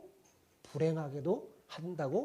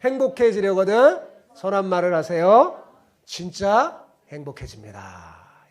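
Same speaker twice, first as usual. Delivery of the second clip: louder, a joyful blessing called out to neighbours; loud group greeting; 3 semitones higher, 13 dB louder than usual. Shown as a demonstration. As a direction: bless the person beside you out loud. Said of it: "자, 서로 인사해봅시다. 시작". (옆 사람에게 큰 소리로 축복하는 인사)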